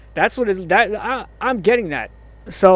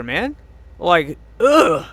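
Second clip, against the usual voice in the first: usual speaking voice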